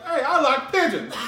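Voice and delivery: high, loud eccentric outburst; hammed-up sudden yell; mock crazed shout. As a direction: holler it like an oddball on the train. Acting out a loud train eccentric.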